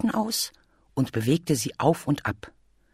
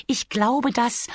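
high, light bee voice; little bee character; 9 semitones higher than usual. Acted. Bee reminds Bear what bears can't do, cautioning. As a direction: voice a small bee, patiently cautioning.

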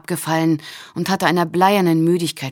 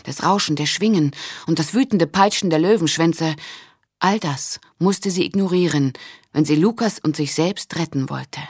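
plain reading voice

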